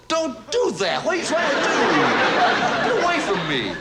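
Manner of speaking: high-pitched, indignant protest; insulted cat. Acted. Cat swatted with a newspaper answering back, insulted. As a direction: squawk in offended outrage. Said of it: "and he was insulted".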